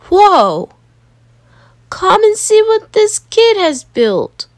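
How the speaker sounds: high, loud and thrilled; amazed beachgoer. Acted. Beachgoer calling the crowd to see, amazed. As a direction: call everyone over in wonder.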